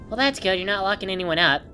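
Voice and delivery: ordinary talk, nothing marked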